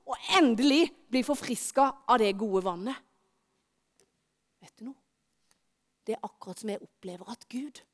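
usual speaking voice

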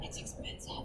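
a whispered gripe about the cost. Real, not acted. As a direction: grumble it under the breath. Muttered complaint about eating out.

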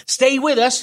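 high, urgent plea to stay awake; urgent first aider. Retold or imitated. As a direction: call out like a panicky first aider. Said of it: "she kept saying".

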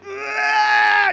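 loud, high mock scream of effort; acted straining yell. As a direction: yell as if prying off a clinging child. Mimes prying clinging child off.

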